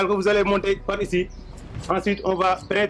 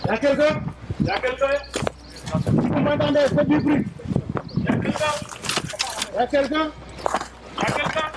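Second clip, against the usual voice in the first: calling from far away; 6 semitones higher than usual. Real, not acted. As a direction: shout out to find survivors. Rescuers calling into collapsed building. (appeler au loin)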